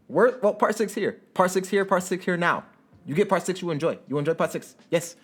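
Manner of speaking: lightly put-on Chinese accent, jokey; mock Chinese accent. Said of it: "I don't know why I went Chinese with that one".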